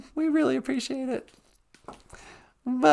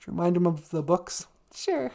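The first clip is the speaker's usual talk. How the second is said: ordinary talk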